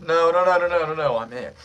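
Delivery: jokey panicked protest; mock panic. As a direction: playfully plead in alarm. Joking plea not to be jailed.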